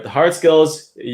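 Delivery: ordinary delivery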